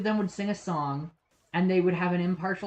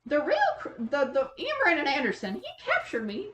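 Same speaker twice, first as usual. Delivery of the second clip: Chris Chan impression; 9 semitones higher than usual. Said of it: "Liquid Chris doing this update".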